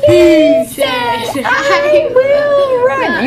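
put-on loud, very high, drawn-out baby wail. Shown as a demonstration. Teacher leading pupils through a sentence in a baby voice, high and loud. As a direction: squeal it slowly like a scary baby. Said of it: "Baby voice".

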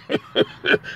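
very high, breathless whispered titter; whispered squeaky giggle. Pretend. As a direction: let out a tiny whispered squeak of a laugh. Signing off with a silly laugh.

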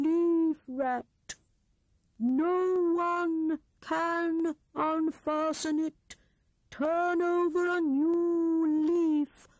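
put-on slow, high, hooting old owl; Wise Owl voice. A narrator's reading. Owl scolding Rat for thieving, slow and hooting. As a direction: lecture like a prim old owl.

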